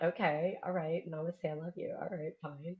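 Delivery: half-hearted mumbled going-along; reluctant mumble. Retold or imitated. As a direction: mutter it like you're humoring the boss. Reluctant group joining the ritual.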